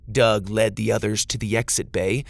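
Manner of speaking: usual speaking voice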